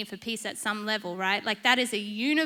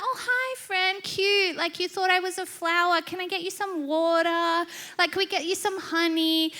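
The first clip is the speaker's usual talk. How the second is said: high, cooing friendly greeting; cutesy sweet; 6 semitones higher than usual. Pretend. Woman offers a little bee water and honey, cutesy cooing. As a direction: fuss sweetly over a tiny friend.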